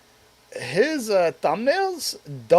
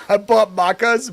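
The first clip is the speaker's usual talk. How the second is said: higher, louder headline read with a laugh; mock title pitch; 6 semitones higher, 6 dB louder than usual. Shown as a demonstration. Streamer inventing a title for Australian viewers, loud.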